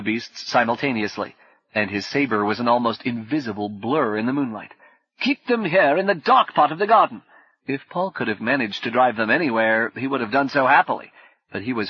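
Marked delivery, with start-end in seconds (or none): 5.2-7.2 loud, high command in battle; shouting orders. Acted. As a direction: bark instructions while fighting. Orders shouted mid-fight.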